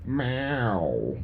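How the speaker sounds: low, lazy suggestive meow; horny cat meow. Pretend. Singer makes a lusty cat noise, low meow. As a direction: purr it out like a cat in heat. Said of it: "That's a horny cat".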